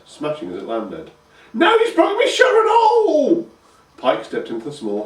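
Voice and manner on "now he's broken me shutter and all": high, loud cackling hag voice; old crone calling. A narrator's reading. Grandma shouting for Pike to mend her roof. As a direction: holler for her grandson like a cranky old woman.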